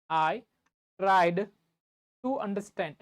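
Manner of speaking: long pauses between the words; halting teacher repeat, piece by piece; word-by-word dictation. Shown as a demonstration. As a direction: break it into pieces for students copying.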